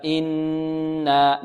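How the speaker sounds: إنا slowly, with a long hummed nn and a stretched aa; ghunna and madd. Shown as a demonstration. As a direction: hold the nasal nun in إنا, then lengthen the alif. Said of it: "مد الألف بمقدار حركتين هكذا". (إنا بغنة ثم مد الألف)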